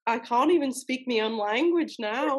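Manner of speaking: laughing echo in put-on British; teasing British copy; mock British accent. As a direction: parrot him in amateur British, "can't" as cahnt. Echoing the Briton's accent.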